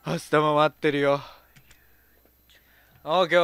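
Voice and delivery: ordinary talk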